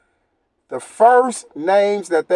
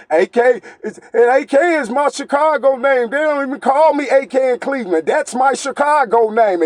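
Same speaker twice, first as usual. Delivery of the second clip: mock the rival, pitched higher; mocking rival quote; 6 semitones higher than usual. Vlogger mocking the rival's Chicago name claim, higher and sneering. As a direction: parrot him like a goofy rat. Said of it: "he gonna sit up there and say".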